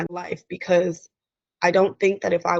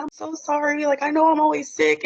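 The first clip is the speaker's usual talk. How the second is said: higher, over-apologetic self-quote; mock apologetic; 8 semitones higher than usual. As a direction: gush apologies like her old self. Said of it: "I was like".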